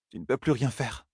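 soft and firm, calming him; Moiraine soothing. A narrator's reading.

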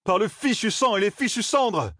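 high, drawn-out angry oath; roared curse. A narrator's reading. Matt curses at his rescued friends, an indignant roar. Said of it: "rugit Matt".

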